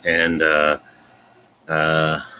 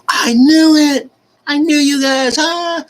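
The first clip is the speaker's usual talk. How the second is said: very high, loud mock squeal; hammed-up ecstatic screech; excited friends' squeal; 8 dB louder, slower than usual. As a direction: shriek it like thrilled friends, drawn out. Friends react to the couple news.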